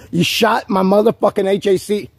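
high, heated accusation with "shot" hit hard; angry accusation. Real, not acted. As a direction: fling the accusation at him, heated. Angry accusation, then calm repeat.

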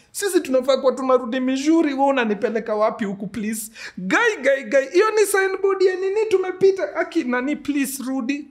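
high, loud frantic begging; panicked pleading. Retold or imitated. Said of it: "So, analia".

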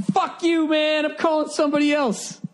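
high, indignant squeal of refusal; mock outraged refusal. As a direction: yelp it back like you're insulted. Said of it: "And I'm like".

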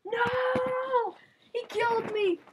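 outraged cry. Real, not acted. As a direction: protest the death. Dies in the game.

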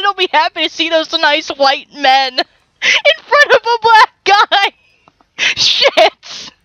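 high voice breaking up with laughter; squeaky, helpless real laughter; laughing hard. Real, not acted. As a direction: struggle to get it out while laughing. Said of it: "I'm laughing so hard".

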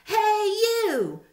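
high, loud squeaky challenge; grouchy ladybug. A narrator's reading. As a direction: pick a fight in a tiny high voice. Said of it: "said the grouchy ladybug".

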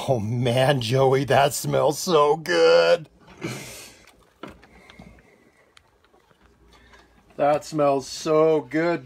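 slow, drawn-out appreciation; slow savoring of a great smell. Real, not acted. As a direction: linger on every word like you're breathing it in.

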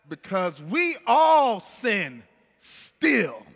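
loud, rising sermon cadence; preaching at full force. Acted. Preacher charging the congregation, loud and building. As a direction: drive every phrase home to the pews.

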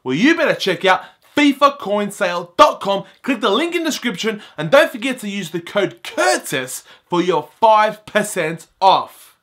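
loud, punchy sponsor plug; upbeat promo read. Acted. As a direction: sell it like a quick radio ad. Sponsor read for coin site.

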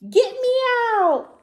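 very high, loud shriek; yelling for help. A narrator's reading. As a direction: shriek like a dentist trapped inside. Swallowed dentist calls for help.

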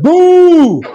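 long, high, loud boo; hooting boo, swooping down at the end; crowd booing. Retold or imitated. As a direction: imitate a jeering crowd with a drawn-out boo. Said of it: "I heard".

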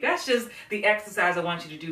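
normal talking voice

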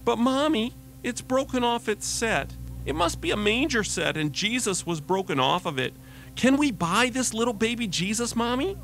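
put-on high, pleading five-year-old voice; little girl voice. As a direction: beg your mom like a small child. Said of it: "Little Sarah said".

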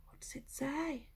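hushed teasing whisper. Acted. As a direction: whisper the question to build suspense. Whispered question to children.

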